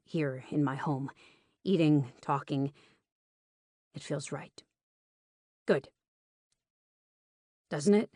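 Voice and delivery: a hint of a man confiding carefully; lightly voiced Rowan. Host admits he feels drawn to her, lightly voiced tenderness.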